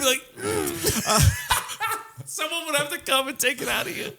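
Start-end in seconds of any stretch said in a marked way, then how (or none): 0.4-4.1 high, soft, laughing words. Real, not acted. Joking that a friend's hairball must be pulled out, laughing. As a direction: giggle it out.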